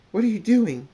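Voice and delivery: high, loud panicked question; alarmed cry. Acted. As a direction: shriek it as he cuts you. Demon cuts her hoof.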